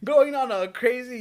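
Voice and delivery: normal talking voice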